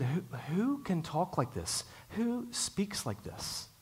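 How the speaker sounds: slow and hushed, rising high; a hushed crowd marvelling at how he talks; awed question. Retold or imitated. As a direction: say it in stunned wonder. Crowds amazed at Jesus.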